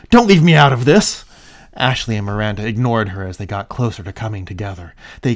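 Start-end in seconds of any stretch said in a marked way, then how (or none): 0.1-1.2 angry shout. Acted. Caitlin continues angrily.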